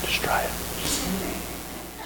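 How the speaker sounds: very slow soft whisper. Shown as a demonstration. Pastor urging a child to try whispering, slow whisper. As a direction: draw the words out in a breath. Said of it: "Just whisper it".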